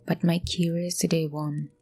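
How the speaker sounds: hushed, measured horror narration; suspenseful story narration. Acted. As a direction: tell it like a creepy campfire tale.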